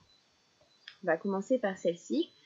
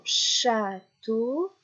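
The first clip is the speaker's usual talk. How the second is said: château dit très lentement, syllabe par syllabe; slow clear château. Shown as a demonstration. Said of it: "répéter trois fois la dernière syllabe". (château, très lentement)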